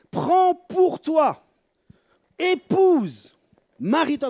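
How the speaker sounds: high, loud, slow exhortation; impassioned sermon call; fervent preaching. Acted. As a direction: urge them to take it. Preaching the command.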